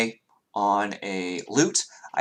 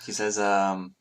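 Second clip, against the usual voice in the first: ordinary talk, nothing marked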